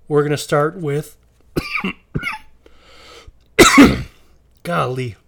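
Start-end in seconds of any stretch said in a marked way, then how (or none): 3.6-4.1 loud hacking cough; heavy cough from the chest; chesty cough. Real, not acted. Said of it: "I'm sorry to keep coughing".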